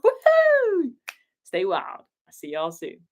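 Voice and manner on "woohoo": excited whoop. Real, not acted. Cheering sign-off.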